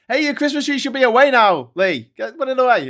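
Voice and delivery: high, loud call across to someone; mock telling-off. Host scolding Lee about the Christmas tree, loud and high.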